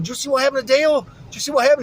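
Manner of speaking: mock-alarmed neighbor rushing up with news; excited question. Neighbor Joe rushes over to ask about the crash, excited and fast. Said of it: "Joe Nuno's comes around".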